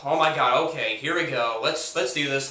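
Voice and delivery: usual speaking voice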